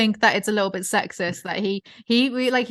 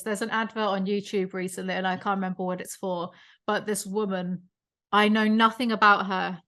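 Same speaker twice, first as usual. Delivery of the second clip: ordinary talk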